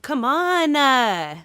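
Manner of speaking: high, loud whiny "Come on, uh"; California tag uh. Shown as a demonstration. Showing Valley speech with a whiny tag. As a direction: draw out the trailing uh. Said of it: "where they add a".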